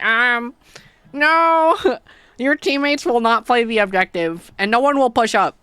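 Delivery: high, loud mock announcement, laughing through it; mocking matchmaking voice. Streamer mocks the matchmaking system in a high, loud, sarcastic voice. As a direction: sing out the bad news like a snooty game. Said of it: "they're like".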